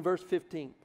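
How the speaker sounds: ordinary talk, nothing marked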